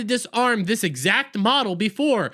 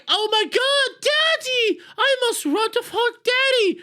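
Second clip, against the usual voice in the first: put-on much higher, squeaky child's voice; mock little girl; 13 semitones higher than usual. Little girl rushing toward her daddy's hologram, squeaky and frantic.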